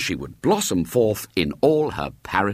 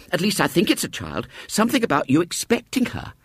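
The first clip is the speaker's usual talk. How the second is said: shaken manageress; 10 semitones higher than usual. A narrator's reading. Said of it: "She looked pale and shaken".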